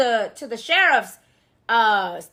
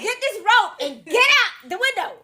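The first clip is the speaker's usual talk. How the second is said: higher-pitched urgent command; exasperated mock order; 8 semitones higher than usual. Rescuer to a dawdling girl, exasperated high-pitched order. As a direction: order them out, fed up with questions. Acts Victor ordering an escape.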